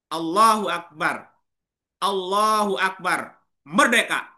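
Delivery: fiery wartime speech to fighters; Bung Tomo rallying speech. Retold or imitated. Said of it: "Kutipan narasi pidato Bung Tomo".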